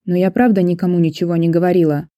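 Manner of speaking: ordinary delivery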